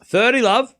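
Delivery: mock umpire calling thirty love; umpire score call. Announcing score umpire-style.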